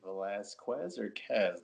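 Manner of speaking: "Velasquez or Quez", trying both last-syllable sounds; weighing two pronunciations. Retold or imitated. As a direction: set the "Quez" endings against each other, slowly. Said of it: "as soon as he said Vel".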